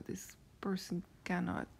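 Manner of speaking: ordinary delivery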